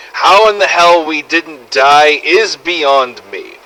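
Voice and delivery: ordinary talk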